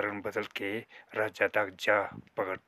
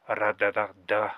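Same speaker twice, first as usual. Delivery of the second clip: ordinary talk, nothing marked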